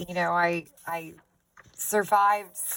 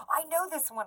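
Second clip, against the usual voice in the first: mock eager know-it-all; 10 semitones higher than usual. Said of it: "you're just sitting there going".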